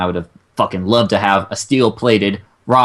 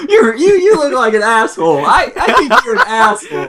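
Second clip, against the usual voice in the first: much higher, giggling through the words; laughing tease; 4 dB louder than usual. Real, not acted. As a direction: laugh as you rib your friend. Teasing friend, laughing through it.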